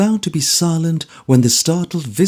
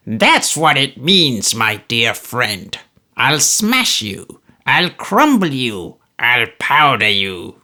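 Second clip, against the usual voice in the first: put-on slow, venomous old man's threats; Smallweed threatening George with slow relish; menacing old miser; slower than usual. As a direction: savour each threat. Narrator voices old Smallweed threatening.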